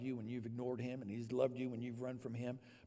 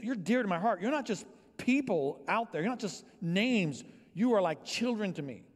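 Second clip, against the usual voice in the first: loud, higher, fervent preaching; preaching at full force; 6 semitones higher, 5 dB louder than usual. Acted. Pastor preaching to his church that it is a family, fervent. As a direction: drive it home to the congregation.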